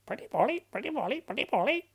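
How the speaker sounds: high squawky parrot; high quick parrot chatter. Acted. Imitating a parrot.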